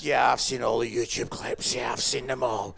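low, monotone mock voice; mocked YouTube addict. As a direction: drone on like someone who only watches clips. Mocks audience who watch every clip.

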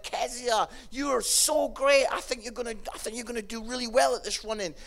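high, loud, eager praise; enthusiastic dad. Retold or imitated. Dad praising his daughter on the drive home, enthusiastic. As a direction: gush loudly with pride. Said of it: "and I'm like".

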